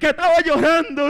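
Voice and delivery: loud, high imitated bawling; exaggerated fake sobbing; mock childish crying. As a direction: wail like a child, hammed up. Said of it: "Se puso a llorar como niño".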